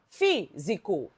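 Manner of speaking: "físico" slow, FÍ, then zi-co; syllable by syllable. Shown as a demonstration. Teacher counting syllables in the word, "físico" split slowly. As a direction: stretch "físico" with a break after FÍ. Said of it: "Tem três sílabas".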